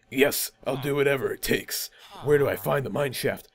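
lightly voiced earnest hero, subdued; understated adventurer voice; willing player character. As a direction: softly pledge yourself to the quest.

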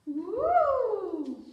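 a loud, cheerful whoop; excited woo. Acted. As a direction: cheer it out for the kids. Waving arms like a monkey.

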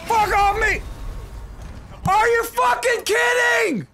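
outraged gamer shout; furious shout. Real, not acted. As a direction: scream it out in real fury. Enraged at enemy in fight.

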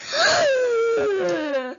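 loud, high wailing gasp sliding down; hammed-up cry of horror; long horrified gasp. Player asked to walk alone, horrified gasp.

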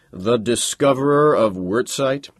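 hesitant, unsure question; uncertainly. A narrator's reading. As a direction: let the doubt creep in.

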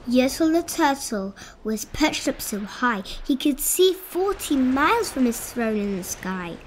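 plain reading voice

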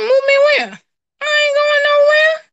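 very high, loud incredulous protest; indignant disbelief. Retold or imitated. Woman balking at the apostle's prophecy, high indignant disbelief. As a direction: squawk back in mock outrage. Acting out her indignant refusal.

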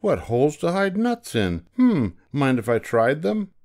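high, keen little squirrel voice; eager squirrel. A narrator's reading. Squirrel asking to try the holes, high and eager. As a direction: ask hopefully, pitched right up.

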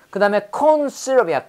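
usual speaking voice

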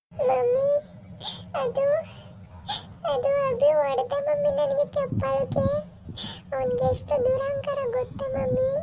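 high, whining tearful voice; tearful complaint. Acted. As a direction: complain to mom like a hurt child. Daughter complains she was slapped.

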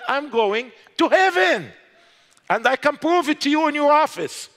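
high, loud confident claim; defiant declaration. Retold or imitated. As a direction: declare it boldly, without fear. Defiant answer to interrogator.

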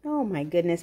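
ordinary delivery, nothing marked

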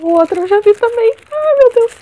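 rising to a high, loud squeal; real, mounting fan excitement; thrilled recognition. Real, not acted. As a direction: build excitedly into an overjoyed cry. Recognises the second wished-for book.

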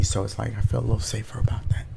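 hushed, rushed whisper to himself; nervous whisper on the bus. Real, not acted. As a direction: whisper it fast so nobody hears.

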